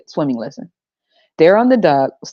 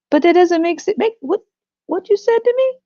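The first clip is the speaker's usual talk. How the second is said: put-on very high, whiny kid voice; child's voice; 13 semitones higher than usual. Kid questions the drowning parent, in a very high voice. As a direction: protest like a confused child.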